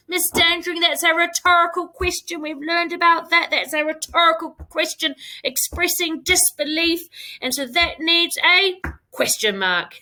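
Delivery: a know-it-all pupil putting her hand up; imitating a pupil. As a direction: play a keen pupil piping up with the answer. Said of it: "You're saying to me".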